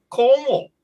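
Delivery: very high, loud mimicked Australian accent. Old local names the old country, exaggerated Australian accent. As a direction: call it out high as an imitated old Aussie. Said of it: "You do that accent really well".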